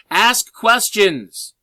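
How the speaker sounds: loud and slow, every word stretched out; emphatic shout. Shown as a demonstration. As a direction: shout it at the class like the one thing they forgot. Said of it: "there's one thing I'm missing".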